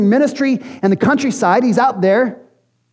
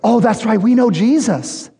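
ordinary talk, nothing marked

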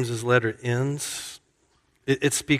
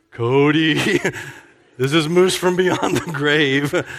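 louder hammed-up ghostly greeting; spooky ghost voice; 3 semitones higher, 5 dB louder than usual. Friend pranking Cody as a ghost, louder mock spooky voice. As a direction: play a joking ghost calling from beyond. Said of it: "and he goes".

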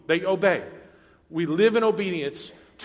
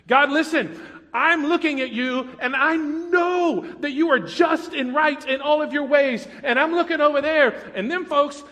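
higher and louder, crying out to God; honest, pleading prayer; 6 semitones higher, 3 dB louder than usual. Retold or imitated. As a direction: plead with God as if shouting up a mountain. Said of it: "That's the kind of way they talk to God".

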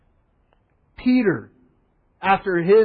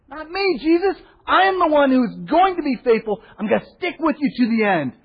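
much higher, pleading insistence; insistent Peter; 8 semitones higher than usual. Retold or imitated. Peter insisting to Jesus he'll be faithful, high and eager. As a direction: protest your loyalty, high and eager. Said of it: "Peter insists".

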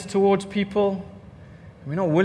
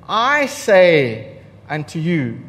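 slow weighty quote; 4 semitones lower, 3 dB louder, much slower than usual. Retold or imitated. Quoting Jesus.

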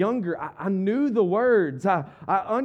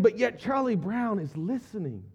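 ordinary talk

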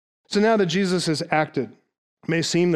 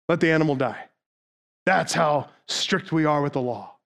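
mock strict sectarian; 2 semitones lower than usual. Voicing the strict Essenes.

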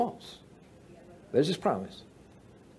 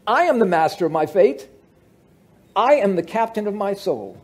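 proud declaration; 5 semitones higher, 4 dB louder than usual. Retold or imitated. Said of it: "He says".

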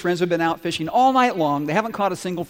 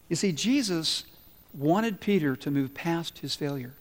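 normal talking voice

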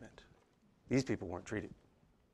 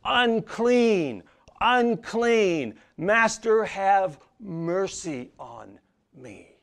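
higher, louder cry of "Unclean, unclean"; pleading yell; 8 semitones higher, 9 dB louder, much slower than usual. Pretend. Pastor voicing sinners crying out to the Master, desperate loud cry. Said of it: "We're the ones that should be yelling".

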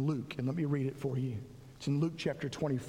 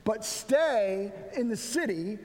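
raised storytelling read of the gospel; scripture reading; 6 semitones higher than usual. Acted. Pastor reading Luke's commission to the church, lifted scripture reading. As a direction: read Jesus' words with lifted, earnest voice. Reads Luke passage aloud.

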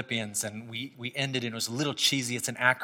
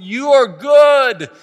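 joyful proclamation; 11 semitones higher, 15 dB louder, much slower than usual. Pretend. Imagined parade singing to God.

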